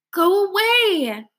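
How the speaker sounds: high, slow, trembling plea; quavering plea. A narrator's reading. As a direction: beg them to leave, shaking. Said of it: "pleads in a quavering voice".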